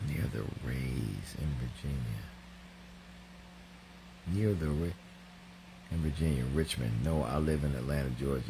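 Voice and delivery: low, quiet, halting read; puzzled reading. Real, not acted. Host trying to make sense of Elnora's comment, quiet halting read. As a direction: read it under your breath, stopping and restarting. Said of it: "I'm reading this like, wait a minute".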